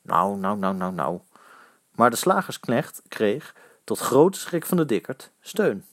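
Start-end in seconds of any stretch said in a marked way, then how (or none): 0.0-1.2 low, repeated hushing; low placating murmur; soothing. A narrator's reading. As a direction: settle them down in a low voice. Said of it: "suste weer".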